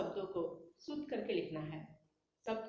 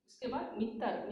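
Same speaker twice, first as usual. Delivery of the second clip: ordinary talk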